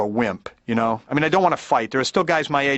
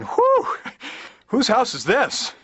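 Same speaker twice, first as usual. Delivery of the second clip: groggy bewildered; 8 semitones higher, much slower than usual. Retold or imitated.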